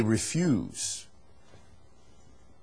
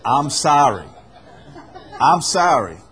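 mock apology; 2 semitones higher, 8 dB louder than usual. Shown as a demonstration. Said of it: "that's how you apologize".

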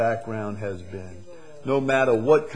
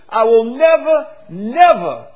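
"never, never" punched much higher and louder; very emphatic never; 12 semitones higher, 13 dB louder than usual. Shown as a demonstration. Preacher repeating the verse to the church, hammering never. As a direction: hammer each "never", the second one soaring. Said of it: "Very emphatic".

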